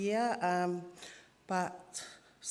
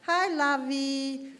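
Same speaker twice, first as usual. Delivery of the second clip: much higher, louder and slow, an aunt's greeting; imitating Auntie Tofi; 6 semitones higher, 5 dB louder, slower than usual. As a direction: stretch out the aunt's hello to a loved one. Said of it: "That's how she always talked".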